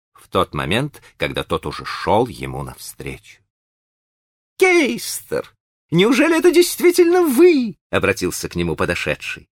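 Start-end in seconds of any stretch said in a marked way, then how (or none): 4.6-7.8 high, bright eager host voice; cheerful high gentleman. A narrator's reading. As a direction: gush over an old friend, high. Green greets Keister.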